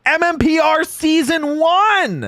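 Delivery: slow, high hype announcement; announcer voice. Shown as a demonstration. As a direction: announce it like a show title. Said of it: "let's bring it back around".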